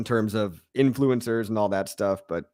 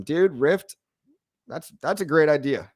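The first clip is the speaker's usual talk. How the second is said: ordinary delivery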